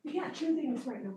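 hushed, distant pet scolding; talking to the dog. Real, not acted. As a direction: gently correct a pet, away from the mic. Turns away to scold dog.

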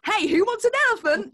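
high, loud, hammed-up party call; rally the kids with mock glee; children's entertainer. Mock children's entertainer calling to kids, hammed up. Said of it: "being a children's entertainer".

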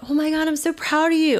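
high-pitched, excited gushing; gushing pride. Retold or imitated. Friend congratulating her after the first zip line, gushing. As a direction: imitate a proud friend squealing praise.

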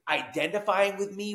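usual speaking voice

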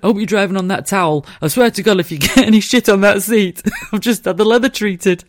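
loud mocking husband voice, high and laughing; fussy husband. Husband on the phone fretting about his car seat, loud mocking voice. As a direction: ham up his panic over the leather.

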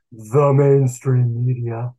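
slow, mocking drawl on mainstream media; sarcastic. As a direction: say it with heavy sarcasm. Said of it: "I get sarcastic".

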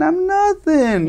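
very high, slow sarcastic I'm nothing; mock agreement. Answering the sergeant who called him nothing, very high slow mock agreement. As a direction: drawl it out high and slow, mocking. Retelling reply to officer.

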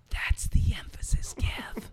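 laughing whisper; giggly prank whisper. Pretend. Joining the whisper joke.

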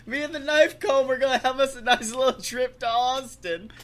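loud, high, gleeful brag; excited boasting. Real, not acted. Bragging to his cohost about the knife comb, loud and gleeful.

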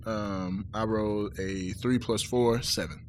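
quiet. Real, not acted. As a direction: mumble it out, ashamed. Embarrassed after failing roll.

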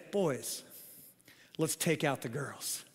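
low, soft scheming aside; conspiratorial hush. Retold or imitated. Father quietly rallies his sons to tip the girls' canoe, low and hushed. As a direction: plot it quietly to your accomplices. Secret plan whispered to sons.

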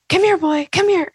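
high and eager, full of energy; dog calling energy. Shown as a demonstration. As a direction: call a dog with bright high excitement. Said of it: "it's this high pitched, lots of energy".

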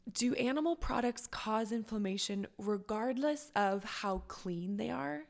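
ordinary talk

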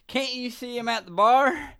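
put-on high, drunken Southern question; drunk Southerner. Drunk passenger to Uber driver, Southern drunk voice. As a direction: play a drunk with a Southern accent. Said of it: "stuck in the Southern accent".

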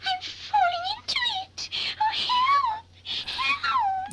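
high, rising cries of help; panicked cries for help. Retold or imitated. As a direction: squeal for help as she sinks. Doll falls into fruit.

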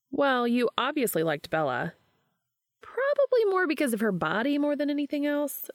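lightly voiced young woman, teasing; a hint of a playful female friend; Charlie, lively friend. A narrator's reading. Charlie voiced higher by narrator.

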